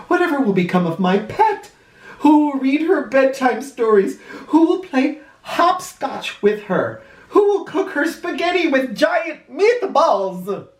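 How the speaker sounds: put-on high, anxious worried old gent; fretting old man. Old man in the ambulance fretting for his flea, high and anxious.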